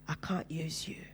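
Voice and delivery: a hushed, breathy voice from beyond, slow; Holy Spirit's whisper. Acted. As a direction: whisper it like a divine voice in the ear. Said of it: "And the Holy Spirit said".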